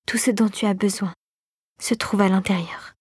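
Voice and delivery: low, slightly hushed mantra; repeating to herself. A narrator's reading. Clara keeps repeating it to herself, hushed. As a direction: repeat it quietly, fading into a half-whisper. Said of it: "se répétait-elle en boucle".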